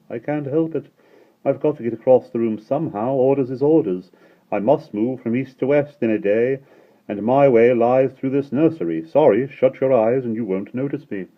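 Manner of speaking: high, loud cheery sunlight; the sunlight's voice. A narrator's reading. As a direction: speak as a bright bustling sunbeam. Sunlight character voice.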